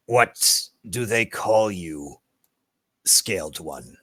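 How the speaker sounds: slow, drawn-out creature question, dropping low at the end; grave lizard folk. Acted. Lizard folk walks over to ask a name, slow and solemn. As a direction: ask with slow reptilian ceremony.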